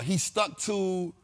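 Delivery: ordinary talk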